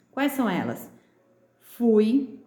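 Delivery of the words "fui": "Fui" said loud, ui ringing out; teacher showing the ui in "Fui"; vowel-sound demo. Shown as a demonstration. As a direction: punch "Fui" so the u-i glide is heard. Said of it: "olha o som".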